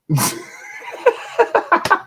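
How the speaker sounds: a high, squeaky burst of laughter. Real, not acted. Storyteller cracks up at his own story, high laughter. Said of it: "trying hard not to fucking laugh".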